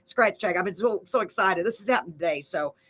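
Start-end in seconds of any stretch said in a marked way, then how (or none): none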